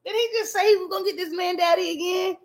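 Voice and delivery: high, fast shocked outcry; can't believe he said it again; shocked squeal on stream; disbelieving. Real, not acted. Shocked reaction on live.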